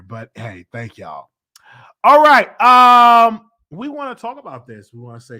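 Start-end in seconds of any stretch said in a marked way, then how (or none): 0.0-1.3 whispered thanks. Real, not acted. Quiet aside to viewers.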